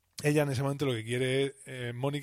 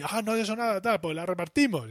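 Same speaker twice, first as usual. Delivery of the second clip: high, indignant protest; higher, indignant mock protest; petty protest; 7 semitones higher than usual. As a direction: refuse indignantly, pitched up. Quarrel over the cheesecake.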